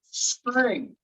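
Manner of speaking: STRING with a plain, unpuffed T; ordinary T. Shown as a demonstration. Teacher showing a regular old T, STRING without aspiration. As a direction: say STRING with nothing special on the T. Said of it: "I didn't do anything special to the T".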